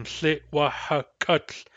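Tlitwahakut stretched out, K starting the last syllable; very slow model. Shown as a demonstration. Teacher showing the moved K, very slowly. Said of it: "the K is at the beginning of the fourth syllable".

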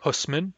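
Husman, gentle H onset; plain H word. Shown as a demonstration. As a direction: say Husman with a faint H. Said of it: "as in Husman".

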